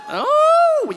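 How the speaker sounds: real delighted surprise at finding his sister; surprised oh. Real, not acted. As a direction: pop up in pitch as you spot her. Spots his sister in the crowd.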